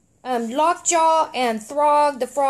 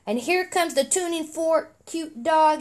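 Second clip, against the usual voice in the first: usual speaking voice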